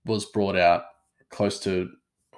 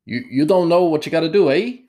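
put-on higher, louder Canadian accent with a tacked-on eh; mock Canadian accent; 8 semitones higher, 7 dB louder than usual. Mocking the game's characters, hammed-up Canadian accent. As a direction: ham up a Canadian game voice. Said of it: "they come across as, like, Canadian".

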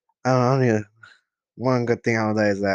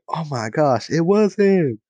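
higher, shocked voice, realizing who shot you; hammed-up disbelief, pitch jumping up; shocked realization; 6 semitones higher than usual. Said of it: "And you're like".

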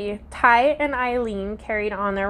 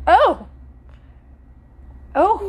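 loud, much higher shocked "oh"; surprised gasp; 10 semitones higher, 6 dB louder than usual. Real, not acted. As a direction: react in sudden shock. Shocked by nickname.

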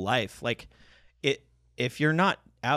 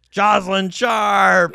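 drawn-out shouted intro, much higher; booming showman intro; 7 semitones higher, 8 dB louder, much slower than usual. Acted. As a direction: stretch the name out big and slow. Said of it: "a bad [name] impression".

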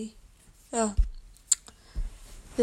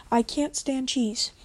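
ordinary delivery

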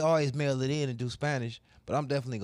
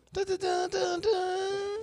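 high-pitched vocal air horn blasts; air horn imitation; much slower than usual. Friend adds all the Jamaican sounds, air horn imitation. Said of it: "I think you get an air horn".

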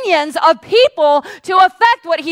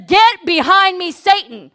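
firm rebuke; 5 semitones higher, 4 dB louder, slower than usual. Acted. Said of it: "and he says".

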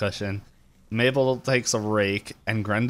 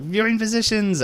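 calling out; 11 semitones higher than usual. Retold or imitated. Ford calls out to the room.

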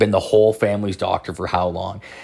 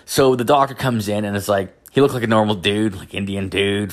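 normal talking voice

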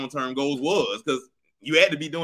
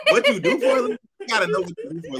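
laughing; 5 semitones higher than usual. Real, not acted. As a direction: squeak up high with laughter. Laughing mid argument.

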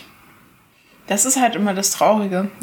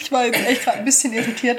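ordinary talk

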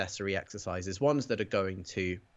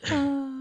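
high burst of laughter; 6 dB louder than usual. Real, not acted. Laughs at own jaded remark.

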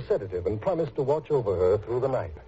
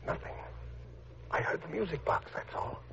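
hushed; 6 semitones higher, 9 dB quieter than usual. Acted. Night vigil, speaking low.

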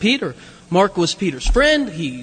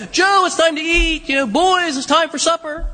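higher, mock call to supper; wife calls Joe and boys to supper, raised call; 6 semitones higher than usual. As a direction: call the family in to eat. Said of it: "But she call".

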